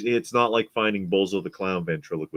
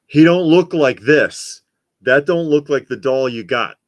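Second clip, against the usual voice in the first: loud disbelief; 4 semitones higher, 9 dB louder than usual. Real, not acted. Exclaiming at the creepy box.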